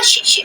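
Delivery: breathy shush to drive off a bird; unvoiced shoo; shooing hiss. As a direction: hiss the parrot away. Shooing parrot.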